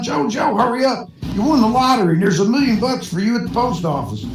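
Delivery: high, hurried cowboy call without real volume; joke-teller's excited pretend yell; mock yell. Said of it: "another cowboy bursts in yelling".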